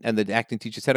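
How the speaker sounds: ordinary talk, nothing marked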